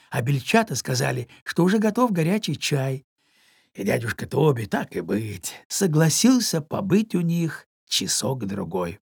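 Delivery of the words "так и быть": plain reading voice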